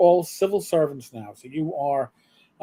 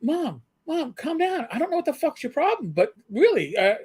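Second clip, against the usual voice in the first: high, half-joking exasperated protest; mock exasperated son; 10 semitones higher than usual. Young cop telling his mother to calm down, high mock exasperation. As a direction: play your younger self waving off mom.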